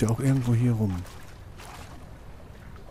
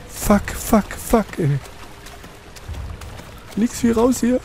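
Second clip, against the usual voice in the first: high frantic panicked yelling; being chased; 12 semitones higher, slower than usual. Real, not acted. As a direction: shout it while running for your life. Fleeing monster.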